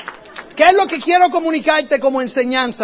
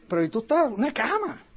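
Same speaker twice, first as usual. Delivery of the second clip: hushed amazement; 6 dB quieter than usual. Retold or imitated. Stunned by bed in car.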